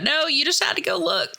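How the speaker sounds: high-pitched mock exasperation; mock scolding. Narrator scolds the kid who went down there, mock exasperated. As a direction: chide him playfully, voice leaping up.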